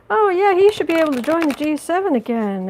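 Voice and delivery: lightly hammed-up impression of a breezy Trump; mock Trump. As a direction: sneer it out like a smug president. Mocking Trump inviting Putin.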